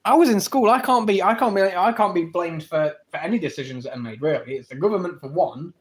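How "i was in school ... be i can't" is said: louder insistence. Shown as a demonstration.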